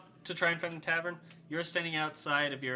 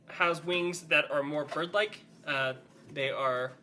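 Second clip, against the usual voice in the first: ordinary talk, nothing marked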